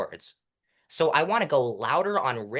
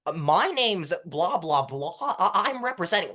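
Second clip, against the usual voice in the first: higher, stilted courtroom put-on voice; mock the fake trial-lawyer voice; fake mock accent; 5 semitones higher than usual. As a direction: stiff artificial "Your Honor" delivery. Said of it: "that sounds fake".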